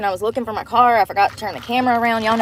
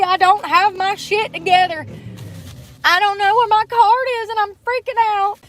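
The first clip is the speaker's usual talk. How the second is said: much higher, mock-panicked self imitation; flustered rambling; 11 semitones higher than usual. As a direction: squeak out the excuses, flustered. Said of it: "I'm like".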